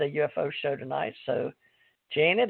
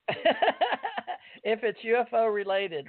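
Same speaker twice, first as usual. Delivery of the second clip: laughing; 5 semitones higher, much slower than usual. Real, not acted. Host laughing through his words.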